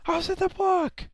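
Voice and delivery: high loud protest; real outraged protest; indignant yell. Real, not acted. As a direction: shout it as pure injustice. Protests death to viewers.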